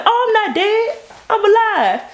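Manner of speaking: actor insists alive. Retold or imitated. Actor proving he's alive.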